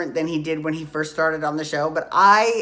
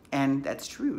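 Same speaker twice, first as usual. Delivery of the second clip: ordinary delivery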